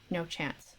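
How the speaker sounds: ordinary delivery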